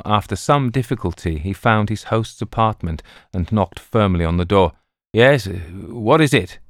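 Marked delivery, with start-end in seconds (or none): 5.1-6.6 groggy, just woken; sleepy. A narrator's reading. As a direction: call out half asleep. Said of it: "called out sleepily".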